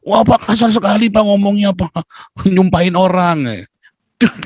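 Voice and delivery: high-pitched mock outrage; hammed-up high whine of complaint; offended protest. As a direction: protest like a scandalised, oversensitive listener. Acting an offended soft-hearted listener.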